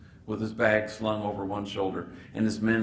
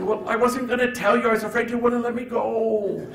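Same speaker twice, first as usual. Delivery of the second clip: put-on much higher, childlike and pleading; innocent kid; 11 semitones higher, 5 dB louder than usual. Gabriel explaining why he hid it, high like an innocent kid. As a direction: sound like a kid caught out. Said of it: "like an innocent kid".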